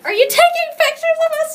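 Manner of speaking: high, loud startled question; high surprised call. Retold or imitated. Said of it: "And I was like".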